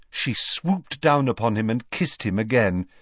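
plain reading voice

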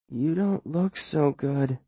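soft slow slurring; slurred and drowsy. Acted. Drugged girl to the boy, soft slurred speech. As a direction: let the words drag, half asleep. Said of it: "her voice slurring".

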